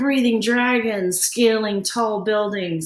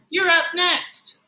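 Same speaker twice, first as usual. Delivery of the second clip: calling out; 8 semitones higher than usual. Acted. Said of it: "Emilio calls out".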